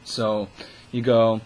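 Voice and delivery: usual speaking voice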